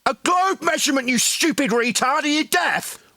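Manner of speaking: high-pitched angry berating; exasperated shouting. Real, not acted. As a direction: yell the demand as if to someone deaf. Shouting down opponent after repeated demands.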